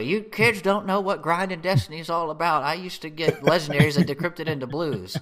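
put-on high, cranky old geezer; old man voice. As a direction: scold the kids like a grumpy old man. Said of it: "waving your cane at people".

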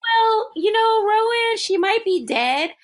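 high-pitched, evasive mock explanation; talking in circles. Retelling her dodging where the little girl is, high evasive voice. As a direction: imitate her going round the point, pitched well up. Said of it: "she's talking in circles".

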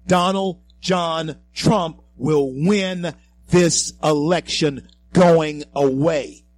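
booming, slow, all-in declaration; loud bold prediction. Real, not acted. Host to listeners, staking his reputation, loud and bold. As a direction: proclaim it loudly and slowly. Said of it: "I've said it loudly".

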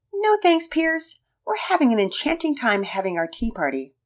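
put-on high, prim little girl. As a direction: answer primly as a little girl. Penelope answers in girl voice.

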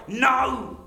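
mock upset. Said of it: "he sounds really upset".

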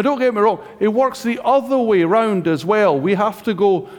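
normal talking voice

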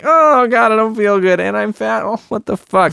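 high, loud whining groan; mock whiny distress. Boy on the toilet overhearing his mom, high whining. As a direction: whine it out like a sick miserable kid.

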